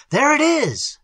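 high-pitched cry of sighting; excited shout. A narrator's reading. Algernon spotting the creature ahead, shouting. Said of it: "shouted Algernon".

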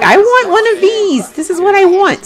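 put-on high, eager child's voice; excited kid. Little brother picks a pet at the store, excited high voice. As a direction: pitch it way up like a kid who wants it now. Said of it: "super excited right now".

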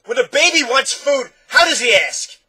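high, full-voiced shouted question; roaring. A narrator's reading. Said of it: "the doctor roared".

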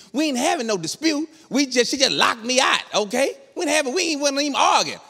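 high-pitched, stumbling mock protest; flustered protest. Husband denying dispute to police, high flustered protest. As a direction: plead innocence, voice climbing high.

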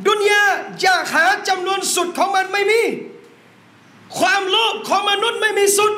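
rising, emphatic sermon cadence; fiery preaching. Acted. Preacher urging the brothers about blessed wealth, emphatic. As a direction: drive each point home to the congregation.

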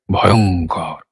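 low, drawn-out word full of feeling; expressive dread. Shown as a demonstration. Teacher shows how the word carries its feeling, expressive dread. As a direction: let ভয়ঙ্কর carry the dread, low. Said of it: "দেখি তো আরেকবার করে".

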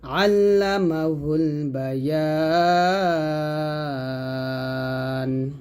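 slow, sustained chant with long held vowels; measured tajweed chanting; Quran recitation. Shown as a demonstration. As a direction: recite it slowly, drawing out each long vowel. Recites both verses together.

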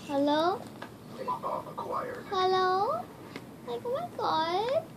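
put-on man putting on a high valley girl voice; trolling in a fake girly voice. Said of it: "I'm gonna be girl, girl voice trolling".

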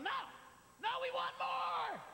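high, angry crowd shout; mock audience yell. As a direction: bellow like a cheated fan. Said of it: "an audience going".

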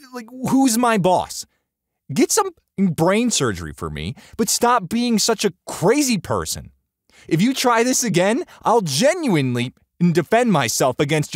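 high, exasperated outburst; loud, incredulous rant at a stranger; angry retort. A narrator's reading. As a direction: tell them off, pitch soaring. Narrator's angry retort in quarrel.

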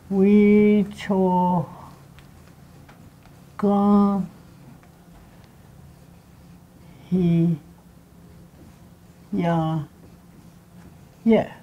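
"We cho ga he ya yeah" one syllable at a time, long gaps; teacher slowly sounding out "We cho ga he ya yeah"; syllable breakdown. Shown as a demonstration. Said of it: "It's a breakdown".

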